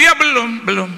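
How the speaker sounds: high, loud, hurried-to-please answer; acted excited phone answer; over-eager reply.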